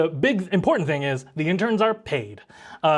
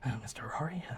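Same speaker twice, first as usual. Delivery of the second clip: very low, hushed, timid mumble; bullied kid's quiet, tentative mutter; shy mumble; 4 semitones lower, 15 dB quieter, much faster than usual. Acted. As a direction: mumble it under your breath, barely audible. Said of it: "you hear him just mumble".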